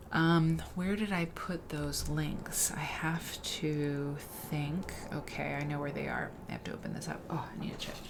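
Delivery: soft whispered self-talk, slowing down; whispering to herself. Real, not acted. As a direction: murmur it under your breath as you hunt for something. Searching for links, talking to herself.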